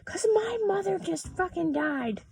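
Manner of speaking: high tight comeback, a little hushed; retorting snap. Retold or imitated. Pilot snapping back at the passenger, high and tight. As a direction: fire back at the rude passenger. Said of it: "And I go".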